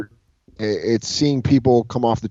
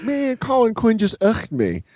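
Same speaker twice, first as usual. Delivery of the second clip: awed disbelief; 11 semitones higher than usual. Retold or imitated. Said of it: "I just remember being like".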